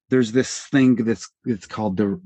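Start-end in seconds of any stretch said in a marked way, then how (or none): none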